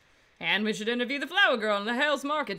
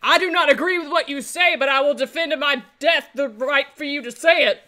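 grand declaiming; 3 semitones higher, 8 dB louder than usual. Acted. Retort in loud mock-profound quote.

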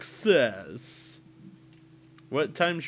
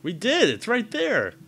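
higher, louder insistence; excited; 11 semitones higher, 8 dB louder than usual. Acted. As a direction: protest loudly. Mock defensive claim.

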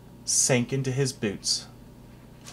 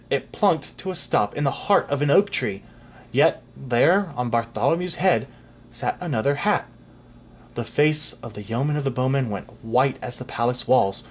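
ordinary delivery

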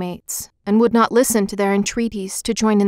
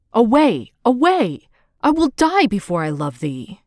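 crying out. A narrator's reading. Narcissus fleeing Echo, mildly raised cry. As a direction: push her away with the words.

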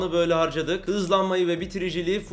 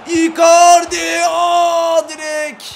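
long, loud, high yell as the ball hits the post; stunned shout; 10 semitones higher, 10 dB louder, much slower than usual. Real, not acted. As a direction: scream the name in disbelief. Top direğe çarpıyor. (direğe çarpınca şaşkın bağırış)

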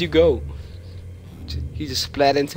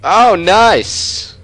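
sarcastic nice; 8 semitones higher, 4 dB louder, much slower than usual. Real, not acted. Sarcastic at gross scene.

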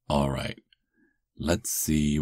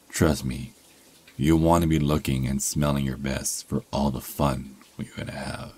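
usual speaking voice

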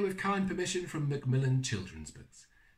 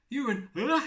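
ordinary delivery

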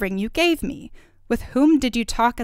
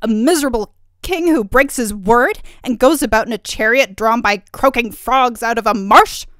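angry; 3 semitones higher, 10 dB louder than usual. A narrator's reading. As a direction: berate him as an insulted princess. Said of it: "cried Turritella angrily".

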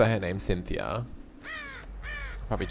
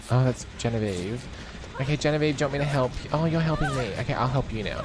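usual speaking voice

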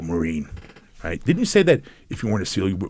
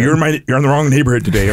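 high, loud and fast, laughing through it; teasing cop taunt, louder and higher; 5 semitones higher, 7 dB louder, faster than usual. Retold or imitated. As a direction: play the cop mocking him with a laugh. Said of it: "He's like".

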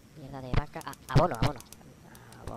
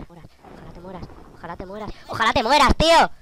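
building angry curse; 5 semitones higher, 12 dB louder than usual. Real, not acted.

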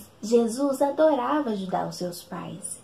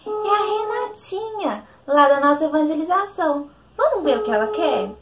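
excited; 6 semitones higher, 4 dB louder than usual. Acted. Phone rings, excited kid host.

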